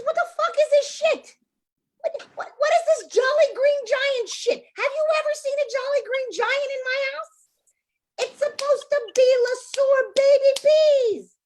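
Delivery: high, loud furious screech at the husband; furious shriek. Acted. Wife screeching at Joey over the wrong peas, furious and high. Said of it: "She goes".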